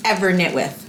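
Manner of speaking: ordinary delivery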